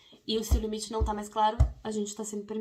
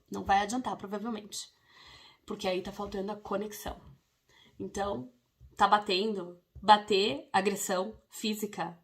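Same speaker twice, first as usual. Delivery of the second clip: ordinary talk, nothing marked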